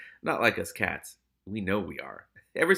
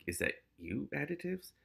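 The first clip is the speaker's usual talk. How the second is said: softer, hushed question; soft worried murmur; whispered; 8 dB quieter than usual. A narrator's reading. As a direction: hush it down to the stomach. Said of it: "he whispered".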